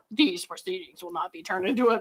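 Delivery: soft mocking echo of the lawyer; mocking quote. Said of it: "And he's like".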